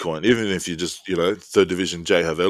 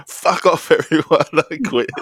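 mock outburst, higher, half-laughing; Chris Lilly impression; 9 semitones higher than usual. Telling mates a teammate quit, higher mock outburst. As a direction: toss it off like a character quitting. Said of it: "the old, uh, Chris Lilly".

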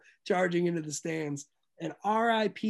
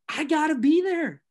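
excited; 8 semitones higher, 5 dB louder than usual. Real, not acted. Raving about the brawl.

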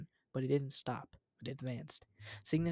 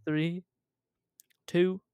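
countdown threat; 4 semitones higher, 8 dB louder, much slower than usual. Acted.

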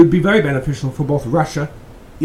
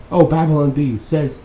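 ordinary delivery

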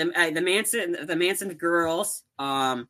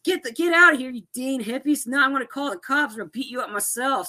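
retold angry yelling; 7 semitones higher than usual. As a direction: imitate someone chasing off trespassers. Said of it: "The guy that just yelled at me".

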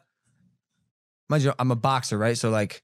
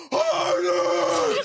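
drawn-out, distorted mock yell; hammed-up demanding shout; 4 semitones higher than usual. Woman demanding more from him, hammed-up shout. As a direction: bellow it long, imitating her.

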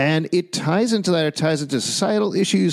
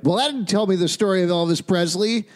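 mock complaint; 7 semitones higher than usual. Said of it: "being like".

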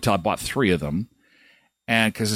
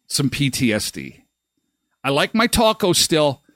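usual speaking voice

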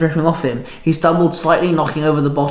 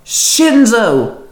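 loud high call of the name; 8 semitones higher, 4 dB louder than usual. A narrator's reading. Penny shouting to Shinzo across the road, loud call. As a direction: shout across the street to him. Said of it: "Penny called out".

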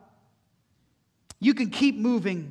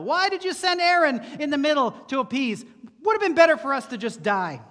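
higher, louder accusing complaint; resentful accusing crowd; 5 semitones higher, 5 dB louder than usual. Acted. The people berating Moses in the wilderness, loud and resentful. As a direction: throw the blame at Moses.

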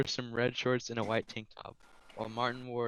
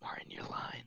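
hushed whisper; 14 dB quieter than usual. Acted. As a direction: breathe the line out. Reading a fanfic line aloud.

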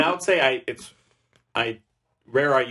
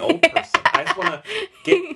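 excited squeal; 5 dB louder than usual. Real, not acted. Wishing to be old, excited.

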